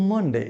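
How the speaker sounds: মানডে with an open "a", "man-de"; corrected Monday. Shown as a demonstration. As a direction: say মানডে with first vowel "ah", not "o". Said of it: "কি হবে?". (মানডে-র সঠিক উচ্চারণ, "মান-ডে")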